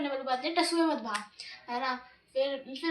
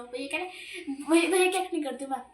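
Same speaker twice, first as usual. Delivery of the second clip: ordinary talk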